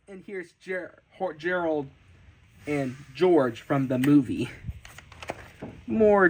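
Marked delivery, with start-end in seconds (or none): none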